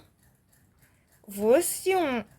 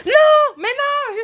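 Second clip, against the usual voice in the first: sudden high, loud cry of protest; shocked shout; 13 semitones higher, 9 dB louder, slower than usual. Real, not acted. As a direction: yelp no at the screen in shock.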